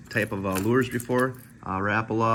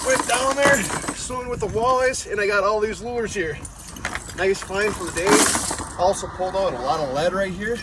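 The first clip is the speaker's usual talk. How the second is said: excited; 8 semitones higher, 4 dB louder than usual. Real, not acted. Excited diver just surfaced.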